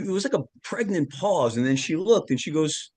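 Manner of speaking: ordinary delivery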